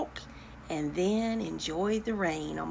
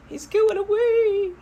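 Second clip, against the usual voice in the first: high, gasped dismay; high mock horror; mock alarm; 12 semitones higher, 5 dB louder than usual. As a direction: say it as a shocked high-voiced owner. Gasps at the story turn.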